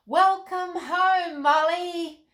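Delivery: loud, high, slow group cheer; a crowd greeting Molly at the palace, cheering loudly; cheering welcome. A narrator's reading.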